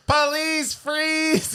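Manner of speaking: very high, hammed-up cop call; squeaky imitation of a police command; mock police order. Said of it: "she's like".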